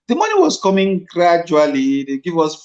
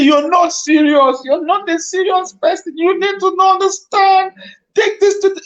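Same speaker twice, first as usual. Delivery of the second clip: much higher, loud tearful rebuke; the director crying as he scolds the young actor; tearful scolding; 3 dB louder than usual. Retold or imitated. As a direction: scold him through tears, pitched way up. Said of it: "counseling me in tears and crying".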